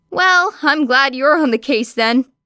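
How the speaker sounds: detective ribbing the grumpy hero; teasing detective. A narrator's reading. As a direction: needle him with mock cheer.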